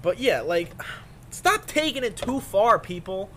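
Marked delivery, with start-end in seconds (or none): 1.3-3.3 scolding. Acted. Scolding the internet.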